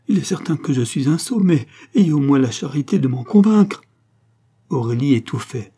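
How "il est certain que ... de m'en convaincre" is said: high, loud exasperated plea; distraught. A narrator's reading. Distraught husband begs his laughing wife, crying out. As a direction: build to a loud, pitched-up appeal. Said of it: "s'écria Raoul éperdu".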